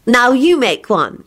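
put-on high, slow girlish instruction; instructing. A narrator's reading. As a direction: instruct him, drawing the words out. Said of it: "she instructed him".

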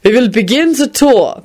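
put-on high, accented announcing; tour guide announcement. A narrator's reading. Guide starting the palace tour, high announcement. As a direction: call the group to order. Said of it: "the guide announced quickly".

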